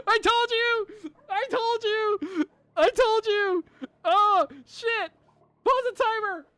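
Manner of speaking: high, loud victory yell; excited yelling, pitched high; vindicated shouting. Real, not acted. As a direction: crow it loudly after proving them wrong. Vindicated after winning.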